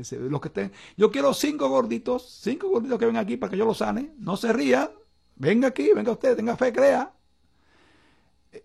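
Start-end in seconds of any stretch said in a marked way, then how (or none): 1.0-7.1 high-pitched imitation of an evangelist's altar call; Pentecostal healer. Host imitates evangelist calling people up to heal, high preacher voice. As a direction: mimic a faith healer summoning the crowd, pitched well up. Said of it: "un señor, eh, pentecostal, este, llamó a la gente".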